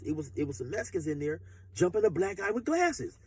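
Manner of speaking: ordinary delivery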